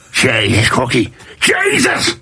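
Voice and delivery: jumping up high on Jesus in disbelief; an exasperated rugby coach's shriek; high-pitched cry of despair at a missed tackle. Retold or imitated.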